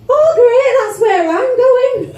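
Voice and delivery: high, loud, slowed wail; self-pitying cry at the talking lift; mock despair. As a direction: wail it like the lift insulted you. Deadpan joke to talking lift.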